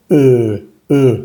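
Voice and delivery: E as a long "eu", mouth nearly closed; drawn-out, falling rounded E; rounded French E. Shown as a demonstration. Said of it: "cerramos la boca".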